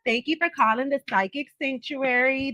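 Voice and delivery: bright call-center greeting; psychic hotline. Acted. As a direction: welcome the caller, announcer style.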